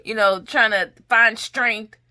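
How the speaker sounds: normal talking voice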